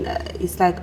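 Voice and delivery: usual speaking voice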